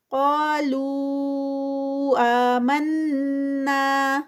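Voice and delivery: slow drawn-out tajweed recitation, vowels held; Quran recitation. Shown as a demonstration. Tajweed teacher reciting a verse for students, slow melodic recitation. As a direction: chant each word with its lengthening. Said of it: "لو پہ four counts".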